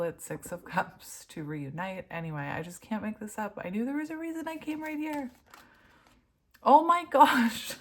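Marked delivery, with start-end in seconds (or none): 3.6-5.3 much higher, fast and amazed; thrilled. Real, not acted. Reader telling viewers she was right to come. Repeated phrase, now thrilled. 6.6-7.7 loud, high, slow laughing exclaim; laughing amazement. Real, not acted. Reader laughing, stunned by the spread.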